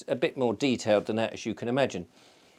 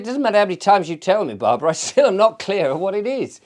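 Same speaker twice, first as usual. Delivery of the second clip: laughing retort; 8 semitones higher, 7 dB louder than usual. Retold or imitated.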